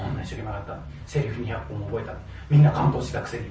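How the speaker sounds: low, hushed bitter grumble; bitter inner voice. Retold or imitated. Boy brooding over unfair gossip, low hushed grumble. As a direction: mutter it to yourself, wounded.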